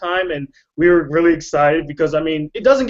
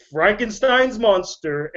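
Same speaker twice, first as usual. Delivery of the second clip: usual speaking voice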